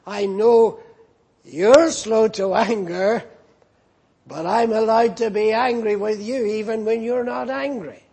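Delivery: indignant retort. Retold or imitated. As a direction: complain to God with mock indignation. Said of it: "Imagine addressing God saying".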